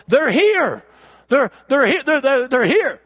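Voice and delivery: breathless, high, rising joy; excited. Retold or imitated. Deacons bursting into church, overjoyed and breathless. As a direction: announce it like rescue just arrived.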